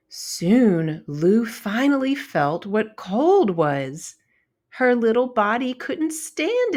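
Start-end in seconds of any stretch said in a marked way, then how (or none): none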